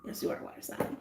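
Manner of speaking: breathy whispered aside; hushed teasing whisper. Real, not acted. Whispered aside to water sign.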